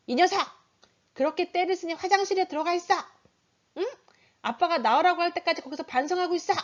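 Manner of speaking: high-pitched, acted telling-off; stern father scolding. Father sending a tantrumming toddler to the bathroom, stern scolding. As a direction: order the child off like a cross dad.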